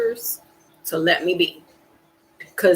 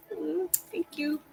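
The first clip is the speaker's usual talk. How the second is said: soft thanks; 7 semitones higher, 15 dB quieter than usual. Real, not acted. Quiet thanks.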